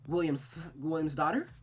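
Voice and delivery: ordinary talk, nothing marked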